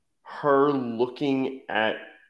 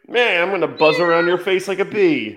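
loud, high pestering robot voice; hammed-up high, loud droid; droid impression; 5 semitones higher, 8 dB louder than usual. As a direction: play a pesky little droid, high and loud. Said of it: "after Lola's like".

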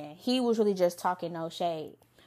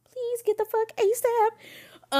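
high mock disgust; 12 semitones higher, much slower than usual. Mocking the date's bragging.

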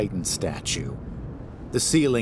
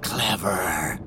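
hissing chorus. A narrator's reading. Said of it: "echo the abominations, hissing".

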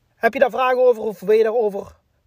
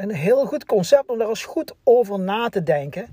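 normal talking voice

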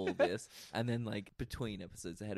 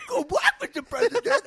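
much higher, louder goofy line reading; 11 dB louder than usual. Retold or imitated. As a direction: squeal the line out like a bad actor. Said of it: "he does a weird voice".

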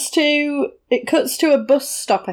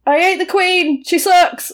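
high-pitched, mock-defiant declaration; hammed-up, raised-pitch insult; provocative taunt; 7 semitones higher, slower than usual. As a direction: goad a policeman into arresting you. Acted provoking a policeman into arrest.

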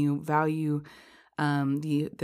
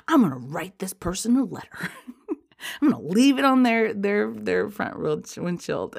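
vengeful threat; 5 semitones higher, 4 dB louder than usual. Retold or imitated. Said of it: "every meaningful bone in my body was like".

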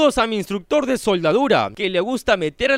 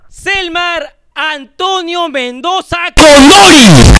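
much louder, slow, higher yell of the name; emotional shout; 7 semitones higher, 13 dB louder, much slower than usual. Acted. Host thrilled by a big tip, excited slow shout. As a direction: call the name out in a burst of emotion. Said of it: "la emoción, la emoción".

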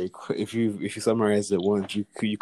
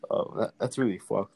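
usual speaking voice

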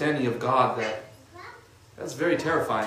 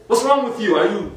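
higher, louder scolding; telling someone off; 5 semitones higher, 4 dB louder than usual. Shown as a demonstration. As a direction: snap at them like telling them off. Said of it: "tell them off".

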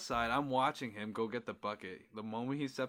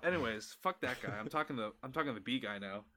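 ordinary delivery

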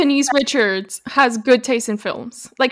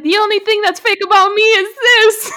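laughing quote; 8 semitones higher, 4 dB louder than usual. Retold or imitated. Quoting housewife's dramatic line.

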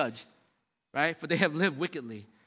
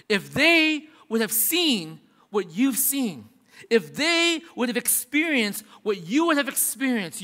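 raised, slow, fervent preaching; preaching at full force; 4 semitones higher, 4 dB louder than usual. Acted. Pastor thunders Jesus' warning to the congregation, preaching at full force. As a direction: drive the warning home to the congregation.

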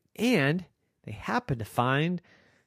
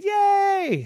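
high, loud squeal of joy; excited cheer; 6 dB louder than usual. Acted. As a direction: cheer like a happy kid. Girls cheer.

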